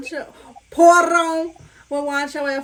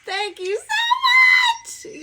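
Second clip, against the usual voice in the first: excited shout; 4 dB louder, much slower than usual. Real, not acted. Emotional loud thanks.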